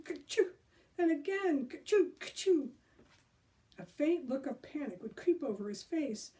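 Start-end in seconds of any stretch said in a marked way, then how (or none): 0.0-2.7 imitated sneezes.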